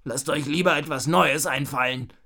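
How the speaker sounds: annoyed robber-knight grumble; growled demand. A narrator's reading. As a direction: grumble an order at the helpers. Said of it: "knurrte Raubritter Ralle".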